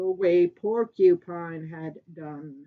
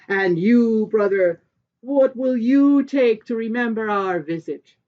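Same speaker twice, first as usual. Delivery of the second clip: loud, hearty king's call across the table; a king loudly offering his brother a gift; booming Sky King; 2 semitones higher, 9 dB louder than usual. Acted. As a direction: boom it out like a generous host.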